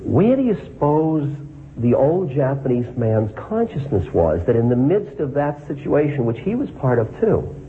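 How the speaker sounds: normal talking voice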